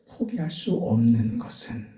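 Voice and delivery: ordinary talk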